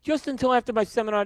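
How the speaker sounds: high-pitched insistent imitation; mock pleading. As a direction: plead his case like the upset host. Imitating Jose pleading with teller.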